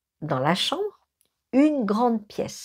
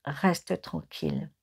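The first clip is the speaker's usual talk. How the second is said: softer and slow, hushed; quiet slow warning in a haunted room; whispered calm; 4 semitones lower, 7 dB quieter than usual. Retold or imitated. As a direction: murmur it slowly to keep him still. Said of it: "Je lui chuchote".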